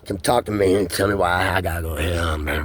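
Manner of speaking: normal talking voice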